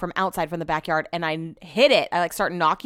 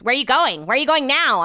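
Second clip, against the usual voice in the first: nagging partner; 5 semitones higher, 5 dB louder than usual. Retold or imitated. Partner's nagging questions, imitated.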